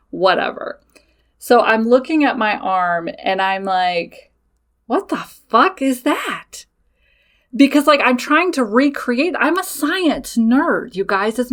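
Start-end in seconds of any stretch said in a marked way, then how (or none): 4.9-6.6 slow, drawn-out shocked question; alarmed disbelief. Retold or imitated. Woman spotting strange scratches, slow shocked disbelief. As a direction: stare at your arm and can't believe it.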